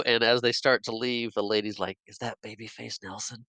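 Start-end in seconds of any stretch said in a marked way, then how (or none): 2.1-3.4 very soft, retold bystander's aside; hushed question. Lady at the bank as the robbers leave, hushed question. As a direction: ask it quietly, as an overheard bystander.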